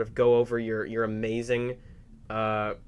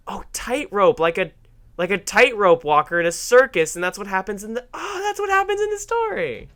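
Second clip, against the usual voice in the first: high, excited re-enacted lightbulb moment; sudden realization; 7 semitones higher, 4 dB louder than usual. Kid realizing what the tightrope episode means, sudden realization. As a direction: act out it finally clicking, voice climbing. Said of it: "it just clicked when I was in".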